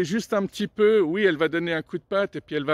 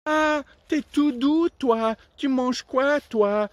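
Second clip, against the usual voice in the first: higher, slow, charmed frog; 5 semitones higher, slower than usual. Acted. Frog talks to the tiger.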